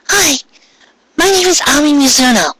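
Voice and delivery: put-on high, polite girl voice; a shy schoolgirl introducing herself; Ami's voice. A narrator's reading. Ami greeting the new girl, high and polite.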